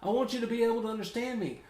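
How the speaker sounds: high-pitched mock self-defense; retold pleading protest. Teased man defending his accent to his buddy, mock pleading. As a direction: plead your case high, acting it out. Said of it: "And I'm going".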